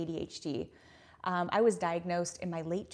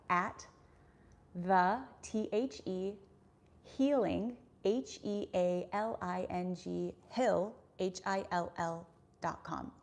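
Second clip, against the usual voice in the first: slow, letter-by-letter dictation; slow spelling out; slower than usual. Real, not acted. Streamer giving viewers her email instead of typing it, said slowly. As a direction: spell it out carefully, pausing between words. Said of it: "I'm gonna say it slowly".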